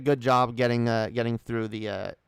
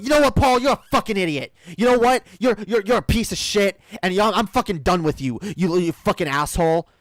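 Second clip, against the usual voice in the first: furious shout, much higher and louder; angry yelling; 8 semitones higher, 8 dB louder than usual. Pretend. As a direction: scream it at him in rage. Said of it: "we would have just started yelling at each other".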